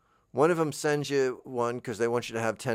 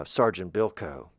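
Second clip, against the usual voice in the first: ordinary talk, nothing marked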